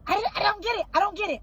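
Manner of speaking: high, loud disbelief; frustrated disbelief at the cheating; exasperated. Retold or imitated. Wife to husband, high and exasperated.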